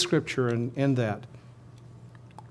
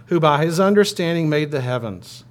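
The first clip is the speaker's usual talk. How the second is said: louder, projected scripture line; cantor's call; 2 semitones higher, 5 dB louder than usual. Pretend. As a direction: call out the verse for the people to answer. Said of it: "I'm the cantor".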